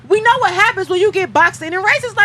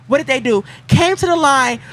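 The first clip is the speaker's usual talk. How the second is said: ordinary talk, nothing marked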